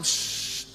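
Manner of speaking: soft breathy 'shh'; shushing. Acted. Preacher as Joshua stopping the grumblers, shushing. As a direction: hush them firmly with air alone. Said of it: "Karena Yosua bilang".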